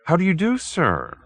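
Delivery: high, fussy polite greeting; a nervous little gentleman bowing in; timid little man. Acted. Hoag greeting the detective, high and fussy.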